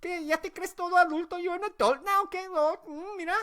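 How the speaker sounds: high-pitched mock aunt voice; imitated disapproving auntie, very high; scolding aunt. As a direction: scold a grown nephew sarcastically, voice way up. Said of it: "She's like this".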